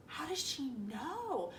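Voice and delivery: hushed, drawn-out puzzlement; puzzled wondering. Retold or imitated. Girl alone puzzling over her mom's text, quietly baffled. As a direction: wonder it softly and slowly, pitch lifting on "know".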